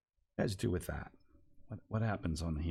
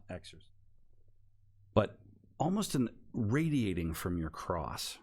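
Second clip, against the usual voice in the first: ordinary delivery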